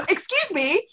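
high, loud, half-laughing "excuse me"; indignant high laughing protest. Retold or imitated. Woman in Lagos shop scolds queue jumper, high laughing outrage.

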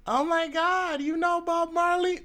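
high-pitched gushing mock American; mock a clueless American fan, voice shooting up; squealing, high mock tourist voice; mock American asker.

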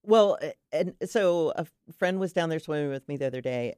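ordinary delivery

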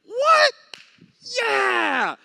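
very high, loud cheer; mock victory shout; wild celebration. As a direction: whoop like a fan after a win.

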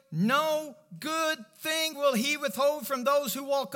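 high, declamatory psalm reading, key phrases drawn out; preached scripture reading. Real, not acted. Preacher giving congregation psalms to say, declamatory. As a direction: proclaim the verse to the room.

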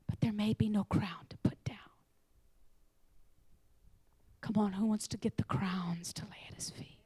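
urgent whisper. Real, not acted. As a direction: challenge them under your breath. Whispered challenge.

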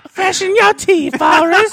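very high, loud, slow mock British voice; high, loud British put-on; British accent. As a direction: squeal it like a cartoon Brit. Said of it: "[name] fucking British accent".

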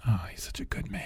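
breathy mock-adoring whisper. Co-host whispers mock adoration of the rich man.

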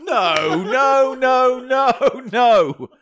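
high, loud hammed-up refusals; mock alarmed protest. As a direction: shout down a joke in mock alarm. Mock scolding in the bit.

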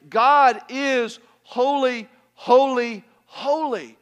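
"holy, holy, holy" loud and high, each one punched; slow, forceful build on every "holy"; emphatic triple HOLY. Shown as a demonstration. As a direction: hammer each "holy" separately, slowly. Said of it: "if you repeated a word once, it was important".

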